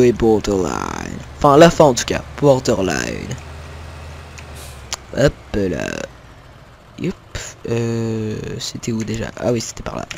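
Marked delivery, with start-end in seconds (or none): none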